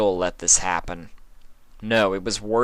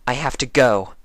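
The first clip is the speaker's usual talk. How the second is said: firm; 5 semitones higher, 3 dB louder than usual. A narrator's reading. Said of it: "Twilight's voice was firm".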